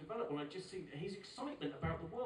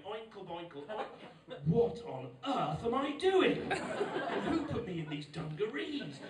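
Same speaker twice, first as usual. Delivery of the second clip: sudden self-consciousness; 3 semitones higher, 7 dB louder, slower than usual. Retold or imitated. Boy running round park loses his joy, suddenly appalled. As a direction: chant dies, then loud, slow dismay. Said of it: "one day that's gonna leave him".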